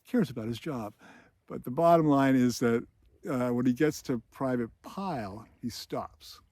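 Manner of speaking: ordinary delivery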